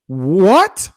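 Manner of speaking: drawn-out, rising incredulous question; utter disbelief. Retold or imitated. Rejected bride reacting to her husband's confession, incredulous. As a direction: let the word climb in mock shock.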